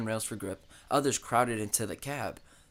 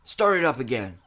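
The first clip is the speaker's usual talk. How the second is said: louder urgent call; 8 dB louder than usual. A narrator's reading. Protesters calling to restart the engine, loud. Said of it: "the protesters called".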